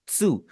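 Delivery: त्सु said with teeth shut on the t, opening into su; Japanese tsu. Shown as a demonstration. Teacher models Japanese syllable for students: crisp त्सु, teeth opening on the u. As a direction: start त्सु on a tiny t behind closed teeth, then release into su. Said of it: "तो ये पढ़ा जाएगा त्सु".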